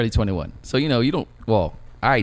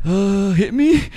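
a touch of sheepish, higher mumble; embarrassed; 9 semitones higher, 4 dB louder, much slower than usual. Retold or imitated. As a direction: ask meekly while blushing. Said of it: "I was just all, like, red".

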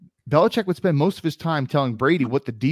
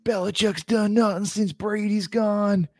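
high, slow mock Boston caller; mock Boston fan accent, high and drawn out; 9 semitones higher than usual. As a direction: imitate an angry Boston sports radio caller, pitched up. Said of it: "calling up the radio stations with their Boston accents".

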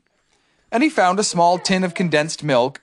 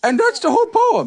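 high, louder, pleased bear voice; proud Pooh; 5 dB louder than usual. Acted. Pooh finishing his poem for Piglet, high and proud. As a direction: announce it proudly. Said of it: "he said".

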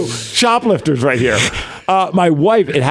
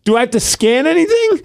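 high confused self-quote; 11 semitones higher than usual. Retold or imitated. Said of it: "I'm like".

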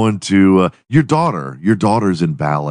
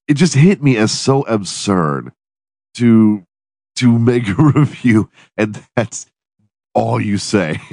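giggling while talking; 4 semitones higher than usual. Real, not acted. Giggling through the explanation.

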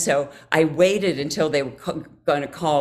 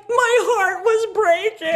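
high, slow tearful wail; hammed-up crying confession; mock heartbreak; 14 semitones higher, slower than usual.

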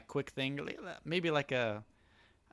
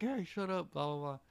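higher-pitched, held-back imitation yell; mock yell; 5 semitones higher, 5 dB quieter than usual. Cohost retelling Sakuragi snapping at his heckling friends, mock yell. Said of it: "yelling at the guys".

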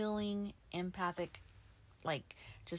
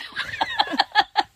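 loud laugh; 11 dB louder than usual. Real, not acted. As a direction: burst of high loud laughter. Laughing at mic rolling.

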